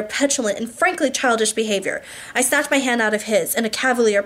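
usual speaking voice

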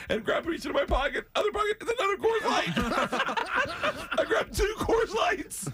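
ramp up into a laughing near-shout; high laughing near-shout; frantic storytelling. Real, not acted.

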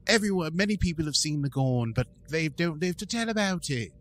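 doing La'an in a put-on British accent. Podcast host imitating La'an in a British accent. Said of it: "your British accent".

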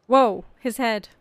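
usual speaking voice

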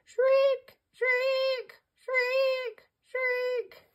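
very high piercing shrieks; parrot shrieks. Shown as a demonstration. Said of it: "Parrots shriek".

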